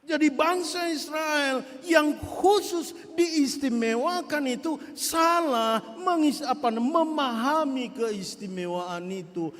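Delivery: ordinary talk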